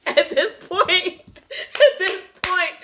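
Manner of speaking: laughing it out, slowed right down; cracking up mid-sentence. Real, not acted. Reader laughing at the plane scene.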